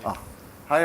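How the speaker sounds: usual speaking voice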